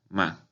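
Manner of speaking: "ma" short and unaccented; neutral tone. Shown as a demonstration. Teacher demonstrating fifth tone, short neutral "ma". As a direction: say "ma" briefly at everyday pitch, no tune. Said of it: "quinto tono es un tono neutro".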